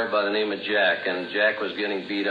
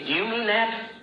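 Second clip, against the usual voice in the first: Sally asking, hurt; 11 semitones higher than usual. Retold or imitated. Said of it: "Sally would say to me".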